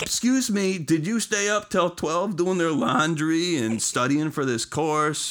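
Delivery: high, slow and indignant. Retold or imitated. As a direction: excuse me, really?. Mock retort to the author.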